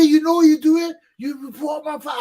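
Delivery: high, loud scolding shout from a startled father; father's angry shout. Retold or imitated. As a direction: yell it at your son as an alarmed dad. Father scolds son over files.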